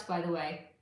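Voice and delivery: ordinary talk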